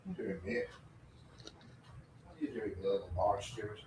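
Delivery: breathy creepy whisper, fast at the end; a hushed, teasing spooky whisper. Pretend. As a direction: whisper it like a lurking stalker.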